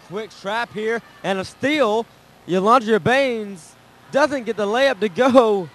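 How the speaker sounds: calling the fast break, voice climbing; excited play-by-play. Real, not acted. Excited play-by-play, home team.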